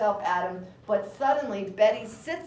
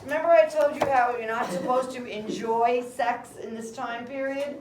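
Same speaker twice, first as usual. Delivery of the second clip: usual speaking voice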